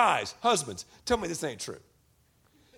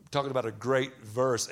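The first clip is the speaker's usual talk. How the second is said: ordinary delivery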